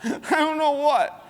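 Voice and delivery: hammed-up frightened squeak; mock scared squeak. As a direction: whimper the answer like a terrified kid. Johnny answers, scared.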